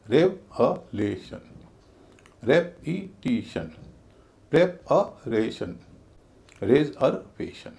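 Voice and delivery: रेवोल्यूशन, रेपिटिशन, प्रिपरेशन, रिजर्वेशन slowly split into syllables; slow, separated syllables in रेवोल्यूशन, रेपिटिशन, प्रिपरेशन, रिजर्वेशन. Shown as a demonstration. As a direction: pull each of रेवोल्यूशन, रेपिटिशन, प्रिपरेशन, रिजर्वेशन apart to show its beats. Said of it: "एक ही trend सब में".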